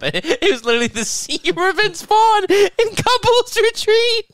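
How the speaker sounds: very high, squealing through laughter; real helpless amusement, pitch shooting up; cracking up. Real, not acted. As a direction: squeak the words out while laughing. Cracking up at brother's tiny bump.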